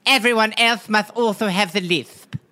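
every s in "also" and "lisp" as th. Acted. King decreeing everyone share it, lisped "must also have the lisp". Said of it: "mortified by his lisp".

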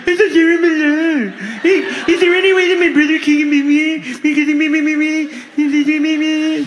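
high, whiny repeated me; whiny brother impression. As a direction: whine like a needy brother. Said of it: "here's an impression".

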